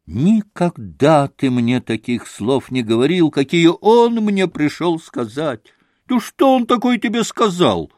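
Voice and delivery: a touch of irritation, rising high at the end; irritable grumble. Acted. Rakitin needling Grushenka, mildly irritated grumble. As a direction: grouse with mild annoyance. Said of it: "раздражительно проворчал Ракитин".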